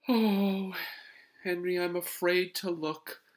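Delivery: high, loud mother voice; mother calling back. A narrator's reading. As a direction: call back from the kitchen, dreading it. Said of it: "answered his mother from the kitchen".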